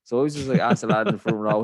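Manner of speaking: normal talking voice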